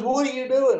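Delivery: high, outraged question; indignant surprise. Retold or imitated. Ed protesting the snatched cookie, outraged. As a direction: squawk it like Ed when the cookie is snatched.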